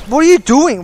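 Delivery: high, loud burst of disbelief; real exasperated outburst; exasperated shout. Real, not acted. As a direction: yell at a player who makes no sense. Exasperated at another player's move.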